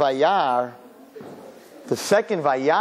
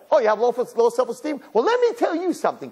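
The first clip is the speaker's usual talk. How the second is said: raised-pitch mock indignation; indignant parent; 8 semitones higher than usual. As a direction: scold with mounting outrage. Parent turning on the kid.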